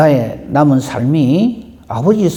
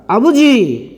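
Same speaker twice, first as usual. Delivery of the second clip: calling out Father, raised high and loud; loud cry; 7 semitones higher, much slower than usual. Retold or imitated. Preacher voicing Jesus crying out on the cross, loud call. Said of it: "예수께서 큰 소리로 이렇게 말씀하십니다".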